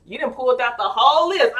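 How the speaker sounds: put-on loud, high, teasing "girl" call-out; playful mock exclamation; excited viewer. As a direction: shout it like a hyped best friend. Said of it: "You're like".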